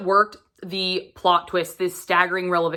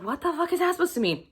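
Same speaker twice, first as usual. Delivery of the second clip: baffled outburst; 8 semitones higher, 4 dB quieter, faster than usual. Retold or imitated. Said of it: "you're just like".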